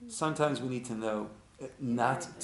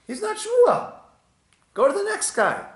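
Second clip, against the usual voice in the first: much higher and louder, fed up; exasperated retort; 8 dB louder than usual. Acted. Stranger telling the seeker to try the next guy, fed up. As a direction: brush him off impatiently.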